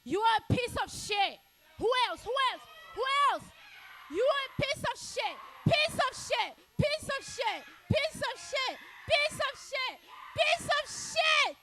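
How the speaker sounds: screaming insults at full force; furious shout. Acted. Comic yelling at a guy in the crowd, furious shout. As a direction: yell it at the heckler, high and loud.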